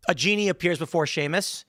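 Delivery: ordinary talk, nothing marked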